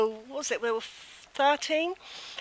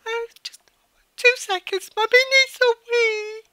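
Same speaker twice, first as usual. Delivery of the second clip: much higher, squeaking through laughter; laughing; 12 semitones higher, 7 dB louder, slower than usual. Real, not acted. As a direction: barely hold it together. Laughing, excusing herself.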